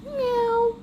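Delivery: high meowing cat. Acted. Dogman meowing high after the ear button is pressed. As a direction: meow like a kitty.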